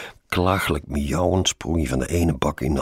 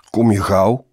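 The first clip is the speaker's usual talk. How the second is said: raised, louder call; 6 semitones higher, 8 dB louder than usual. A narrator's reading. Colleague below hurrying his sleepy passenger, calling out. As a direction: call up to someone at a window. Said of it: "riep hij".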